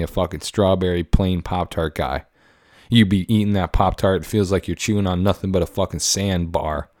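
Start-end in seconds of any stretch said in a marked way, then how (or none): none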